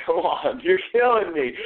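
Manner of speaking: high-pitched exasperation; high, fed-up complaint; exasperated plea. Real, not acted. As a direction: plead with a stubborn dog. Pleading with disobedient dog.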